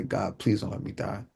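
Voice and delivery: soft, subdued retold prayer; quiet plea. Wounded boy prays looking up through tracks, quiet plea. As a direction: pray under your breath. Praying under the train tracks.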